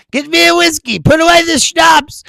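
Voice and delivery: loud, high, hammed-up command; mock newly minted Scot. Man from the ad embracing being Scottish, mock bellowed order. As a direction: call out the order with overblown gusto. Acted order from the new Scotsman.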